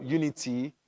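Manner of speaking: normal talking voice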